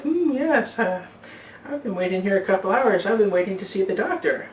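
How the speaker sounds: put-on high, thin old lady voice, a bit quick; old woman voice.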